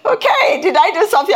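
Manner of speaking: high, loud mock surprise; mock bewildered. Preacher acting baffled at people staring. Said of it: "I'm like".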